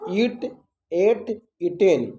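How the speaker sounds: slow recitation, eat high, then एट, eaten; memorisation drill. Shown as a demonstration. Teacher shows pupils how to memorise, slow recitation. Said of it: "इसी तरह याद कीजिएगा".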